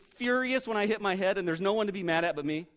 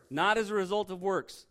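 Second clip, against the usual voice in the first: ordinary delivery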